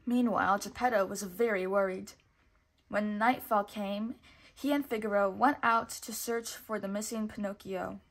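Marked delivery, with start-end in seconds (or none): none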